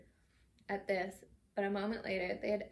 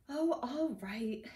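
grudging slow snap, louder; snapped; 4 semitones higher, 4 dB louder, much slower than usual. A narrator's reading. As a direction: give in with irritation. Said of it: "he snapped".

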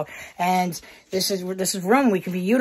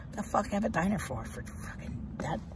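whispered grumble; 12 dB quieter than usual. Real, not acted. Vlogger muttering about the closed diner, whispered. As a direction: grumble under your breath, barely voiced.

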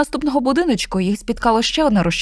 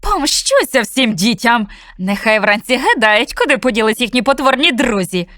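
slow, louder villainous gloat; gloating witch; 3 semitones higher, 4 dB louder than usual. Acted. Witch gloats to herself walking the town, slow and louder. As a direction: savour every threat. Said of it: "Задоволено вона промовляла до себе".

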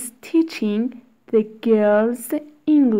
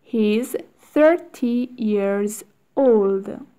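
slow, careful drill reading; slow model reading; slower than usual. Shown as a demonstration. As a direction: stretch it out for learners. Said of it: "teacher models drill slowly".

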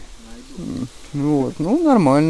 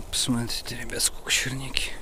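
whispering; 3 semitones lower, 10 dB quieter than usual. Real, not acted. Hushed aside to viewers.

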